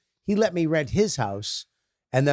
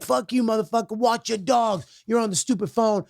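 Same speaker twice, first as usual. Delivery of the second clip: high-pitched mock street rant; angry stranger, retold; 7 semitones higher than usual. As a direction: imitate an irate man telling you off. Voices the man quarrelling back.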